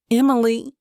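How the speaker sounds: calling her name, pleading; urgent plea. Acted. Declan pleads as she pulls away.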